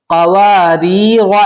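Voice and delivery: कवारीर with a thin first र and a heavy second र; slow model reading. Shown as a demonstration. Teacher showing pupils the two र sounds. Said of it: "उसके बाद देखो".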